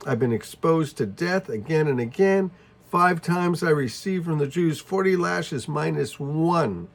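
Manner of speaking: a preacher hammering home every danger; forceful preaching. Acted. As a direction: pile up each hardship with rising fervour. Preaching Paul's hardships with force.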